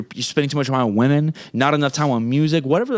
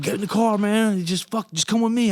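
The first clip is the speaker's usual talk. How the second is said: higher pushy order to get in; insistent friend; 8 semitones higher than usual. Retold or imitated. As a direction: imitate the friend hurrying him into the car. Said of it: "he's like".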